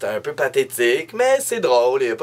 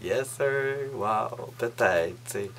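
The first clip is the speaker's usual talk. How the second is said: softer, quietly hopeful musing; hopeful inner thought; 2 semitones lower, 9 dB quieter than usual. Retold or imitated. Hoping the date will call, softly musing. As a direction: whisper the hope to yourself. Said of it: "Je me suis dit".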